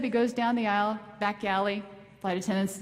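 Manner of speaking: usual speaking voice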